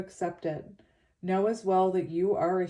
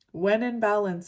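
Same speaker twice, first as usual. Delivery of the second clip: ordinary talk, nothing marked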